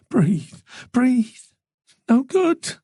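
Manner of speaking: rising towards a tearful cry; overwhelmed, voice climbing high; fighting back tears. A narrator's reading. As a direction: try to breathe, then lose control. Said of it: "as the tears came out of nowhere".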